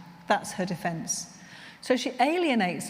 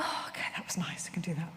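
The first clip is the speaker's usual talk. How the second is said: breathy whisper, much softer; hushed whisper; 4 semitones lower, 9 dB quieter, faster than usual. Retold or imitated. Calmed client answering the chaplain in a whisper. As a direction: hush it like you barely dare speak.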